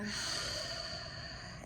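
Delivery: long, airy sighing exhale; audible exhale. Shown as a demonstration. Teacher exhaling to release the past year, long sigh. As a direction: let the breath sigh all the way out. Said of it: "And exhaling".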